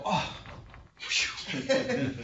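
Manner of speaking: exhaled sigh of relief; mock relief. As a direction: blow out a relieved breath.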